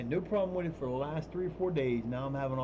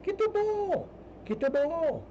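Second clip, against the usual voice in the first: high, loud coaxing call to the cat; very high, loud pet command; mock urging call; 4 dB louder, slower than usual.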